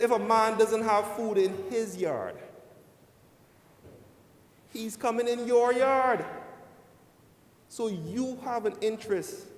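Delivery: loud and high, like a performer. Retold or imitated. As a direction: call it out to the crowd. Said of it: "He says".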